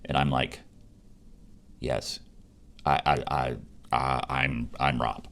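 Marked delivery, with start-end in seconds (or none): 1.8-5.3 quiet, halting stammer; nervous fan meeting his idol, halting stammer; stammering starstruck. Retold or imitated. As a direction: stumble over your own name in awe. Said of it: "basically how I said it".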